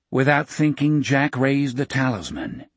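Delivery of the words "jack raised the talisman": plain reading voice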